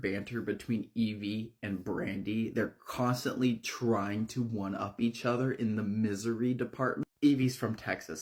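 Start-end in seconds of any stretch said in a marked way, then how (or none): none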